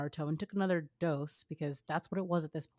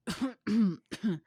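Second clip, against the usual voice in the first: cough; 5 semitones higher, 4 dB louder than usual. Acted. Choking on vodka gulp.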